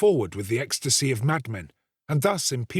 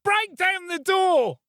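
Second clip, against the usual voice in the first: put-on boy screaming; slower than usual. Boy screams to the crowd to break in. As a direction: urge the crowd on with everything he has. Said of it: "screamed the boy".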